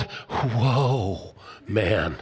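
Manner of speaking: "whoa" drawn out and sinking low, then "man" with a laugh; whoa, man pun. Acted. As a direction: split "woman" into a dropping "whoa" and a laughing "man". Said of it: "That's in the original Hebrew".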